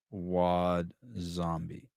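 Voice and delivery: "Wad Zombie" stretched very slowly; slow, relishing "Wad Zombie"; drawn-out name. Shown as a demonstration.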